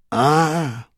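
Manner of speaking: a drawn sigh from the king. A narrator's reading. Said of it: "sighed His Majesty".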